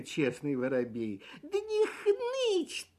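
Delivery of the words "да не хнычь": high, slow, chirpy sparrow voice; cheerful chirp. A narrator's reading. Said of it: "весело чирикнул он".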